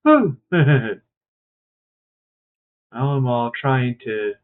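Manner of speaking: ordinary talk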